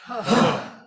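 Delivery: loud, forceful exhaled huh; powerful huh. Shown as a demonstration. Leader exercising the group's voices, forceful huh. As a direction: push the breath out in one burst. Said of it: "with a powerful".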